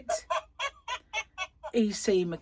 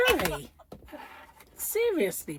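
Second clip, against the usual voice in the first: ordinary talk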